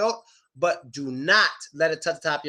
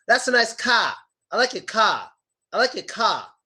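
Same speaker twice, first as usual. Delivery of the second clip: put-on Boston accent, R dropped from "car"; 4 semitones higher than usual. Teacher imitating people from Boston, Boston accent. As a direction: imitate a Bostonian saying "cah". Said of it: "people from Boston".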